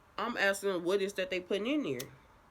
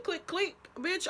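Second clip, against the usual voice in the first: mocking; 14 semitones higher, slower than usual. Mocking the nurse back.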